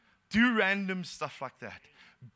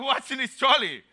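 higher, louder mock-alarmed question; alarmed disbelief; 7 semitones higher, 5 dB louder than usual. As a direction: retell it as a husband balking at the cost. Alarmed husband repeats his question.

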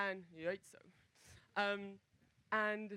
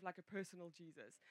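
normal talking voice

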